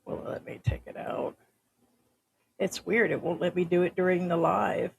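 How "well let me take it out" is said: whispering. Real, not acted. Muttering to self at phone.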